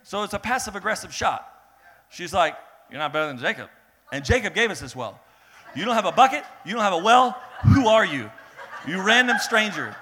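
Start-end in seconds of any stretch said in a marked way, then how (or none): 2.9-9.9 loud mocking challenge, higher and louder; sassy taunt. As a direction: throw each line at him like a jab. Woman taunting Jesus at the well.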